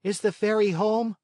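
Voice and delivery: put-on a boy calling up eagerly; marionette asking. A narrator's reading. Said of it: "asked the marionette".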